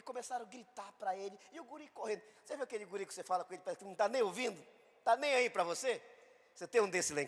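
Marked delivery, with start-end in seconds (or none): none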